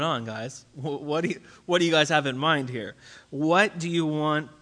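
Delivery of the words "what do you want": slow, deliberate questioning; slow searching question; slow probing question. Retold or imitated. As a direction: ask it slowly, pressing them to answer. Jesus pressing the disciples.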